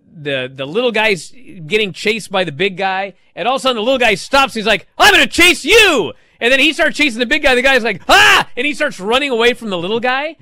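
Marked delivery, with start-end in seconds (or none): 5.0-6.1 loud, high cartoon little-guy shout; hammed-up high yell; feisty cartoon threat. Voices cartoon little guy turning. 8.1-8.5 very high, loud mock scream; short hammed-up yelp of fright; startled cartoon scream. Voices cartoon big guy fleeing.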